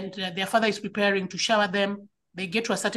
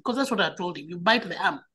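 normal talking voice

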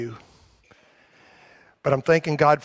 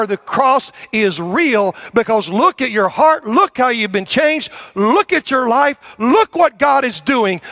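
rising higher and louder, slowed; building preaching; 8 semitones higher, 5 dB louder than usual. Real, not acted. Pastor urging the congregation to see their change, building louder. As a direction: build each "look" up like a rallying cry.

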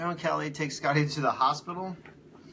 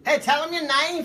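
Willie Flan; 10 semitones higher than usual. Acted. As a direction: stretch it out as a goofy EMS guy, pitched up. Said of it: "I'm gonna be Willie Flan voice".

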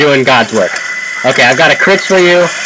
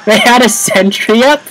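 incredulous; 8 semitones higher than usual. Real, not acted. Spawn camped by sentry.

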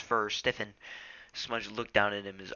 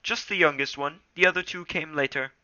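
Rusty voice; 7 semitones higher, 7 dB louder than usual. Acted.

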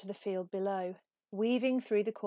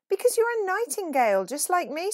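bright bird voice; 10 semitones higher, 8 dB louder than usual. A narrator's reading. Nightingale answering Peep.

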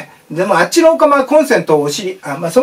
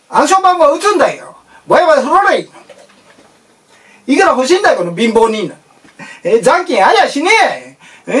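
Edokko ATM; 5 semitones higher than usual. Retold or imitated. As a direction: play a rude Edo-born ATM. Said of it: "エーティーエムがもう江戸っ子".

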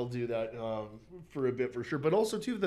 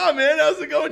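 mock casual greeting; 12 semitones higher, 12 dB louder than usual. Acts out oblivious chat with cop.

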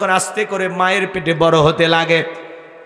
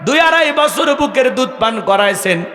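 impassioned preaching; 9 semitones higher than usual. Real, not acted. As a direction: press the mother's suffering home. Preacher stresses mother's suffering.

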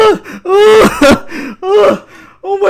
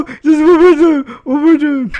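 ordinary talk, nothing marked

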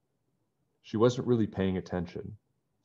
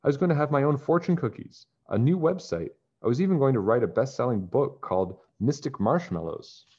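higher-pitched, aggrieved listing; indignant, gritted teeth; 6 semitones higher, 4 dB louder than usual. A narrator's reading. Deidre tells Toby what she has lost, indignant. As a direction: complain about every lost plan, mildly fuming. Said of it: "she said between gritted teeth".

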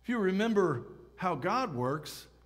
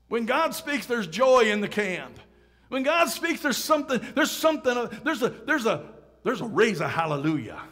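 loud, high, punchy repetition; fired-up preaching; 5 semitones higher, 6 dB louder than usual. Real, not acted. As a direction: stir the congregation up, tripping over repeated words. Preacher at full force stirring congregation.

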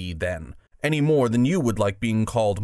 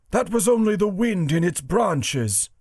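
high warthog character voice; 8 semitones higher than usual. Acted. Warthog answering the badger, high warthog voice. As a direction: dismiss it in a raised warthog voice. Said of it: "said the warthog".

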